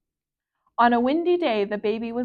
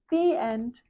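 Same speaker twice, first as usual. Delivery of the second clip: ordinary delivery, nothing marked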